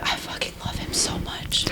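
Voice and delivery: hushed, whispered gushing; fervent whisper. Real, not acted. Fan confessing love for a favorite book character, fervent whisper. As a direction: whisper it like a heartfelt aside.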